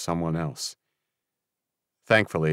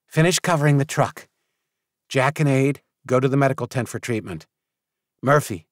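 higher, brisk take-charge leader voice; brisk commands; 6 semitones higher than usual. A narrator's reading. Team leader briskly giving orders to her people. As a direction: snap out orders like a field commander.

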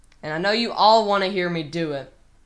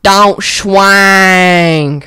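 much louder, stretched out yell; iconic old line; 13 dB louder than usual. Retold or imitated. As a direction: belt the old catchphrase with full force. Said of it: "I'll say it again for you".

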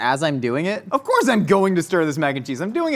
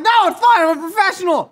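high, loud mock shout; retold defensive yelling; 5 dB louder than usual. [name] reassures the watching crowd, retold defensive yelling. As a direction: yell it back indignantly, pitched way up. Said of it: "then I started yelling".

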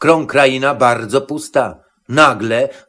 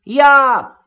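much higher, eager goat cry; high-pitched call from a little goat; Matołek crying out; 10 semitones higher, 3 dB louder than usual. A narrator's reading. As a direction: pipe up high to volunteer. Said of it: "zakrzyknął w głos koziołek".

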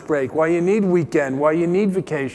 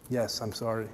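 usual speaking voice

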